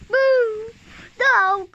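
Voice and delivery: high loud playful shout. Pretend. Child playing scared, shouting.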